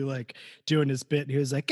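normal talking voice

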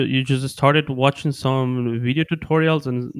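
ordinary delivery

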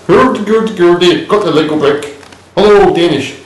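high mock Danish accent; hammed-up Danish gibberish. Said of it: "the Danish speak like this".

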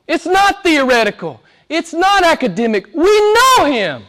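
high, rising pulpit cry with stretched key words; full-force preaching. Real, not acted. Preacher urging the church that they know God, full-force preaching. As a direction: build to an impassioned high peak.

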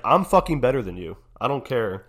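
loud, cocky rival boasting; mock trash talk. Hockey star taunting his rival, loud and cocky. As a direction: puff up and gloat at the rival.

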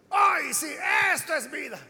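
a hammed-up Esaú delighted with his meal; satisfied exclamation. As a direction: exclaim it like a full, content man. Esaú satisfied after eating.

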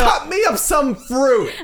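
very high, slow demanding squeal; hammed-up little-kid demand; mock bratty kid. Mock child ordering mother around, bratty squeal.

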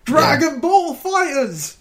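high, slow shouted title; all caps reading. Shown as a demonstration. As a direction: bellow the title like caps lock. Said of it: "like you're shouting it".

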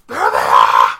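low, loud battle cry; calling out the target. Retold or imitated. Pirate crew rounding the island on arriving ships, shouting. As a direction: bellow it as you round the island. Ambushing crew spots its prey.

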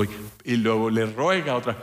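ordinary talk

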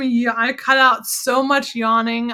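ordinary talk, nothing marked

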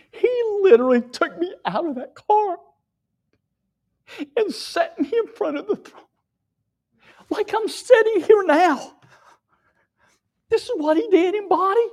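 high and loud, driving the point home; full-force preaching. Real, not acted. Preacher testifying to the church at full force. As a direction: preach it with fervour.

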